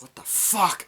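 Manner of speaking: high, loud startled yell; mock shout. Norton startled by the attacker, yelling at him. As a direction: bark it out in shock, high. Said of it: "where Edward Norton's like".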